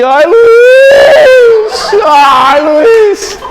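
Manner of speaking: hammed-up high wail, name drawn out long; imitated screaming. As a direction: scream the name like an exaggerated man in agony. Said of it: "começou a gritar meu nome". (gritando de dor, imitação exagerada)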